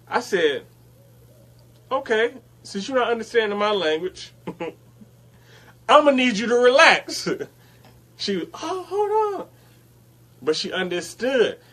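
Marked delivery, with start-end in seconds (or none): 1.9-7.3 louder, higher, laughing through a mock outburst; retold blowup; losing it. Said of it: "And I lost it". 8.5-9.5 high, taken-aback coworker; mock startled coworker.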